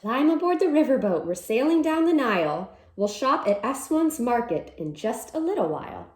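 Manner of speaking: lively storybook read-aloud; reading to children. Acted. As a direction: read it like a cheerful picture-book chant. Reads picture book aloud.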